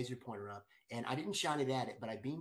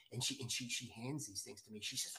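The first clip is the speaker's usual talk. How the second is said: normal talking voice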